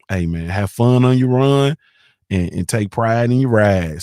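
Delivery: drawn out, savoring each rhyme; slow proud slogan. Retold or imitated. Reciting his uncle's riding motto, slowly.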